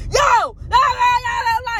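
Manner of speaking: high, loud shouted gibberish; hammed-up loud stranger; imitating loud man. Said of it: "That's how loud he was".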